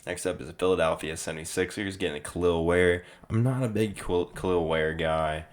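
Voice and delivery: ordinary delivery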